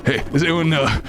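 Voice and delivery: lightly voiced stoner ape; a hint of Seth Rogen begging for bananas; Seth Rogen Donkey Kong. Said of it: "Seth Rogen as Donkey Kong".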